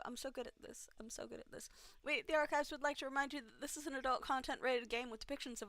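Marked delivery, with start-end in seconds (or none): 0.1-1.7 quiet self-mocking mutter; muttered sarcasm. Real, not acted. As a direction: grumble it under your breath. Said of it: "self-mocking after blunder".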